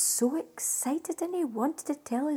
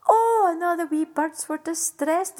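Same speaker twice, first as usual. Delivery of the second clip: ordinary delivery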